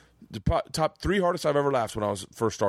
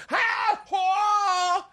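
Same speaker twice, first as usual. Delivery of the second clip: fake laugh. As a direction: bark out a fake laugh so the headliner hears. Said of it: "putting on the laughter".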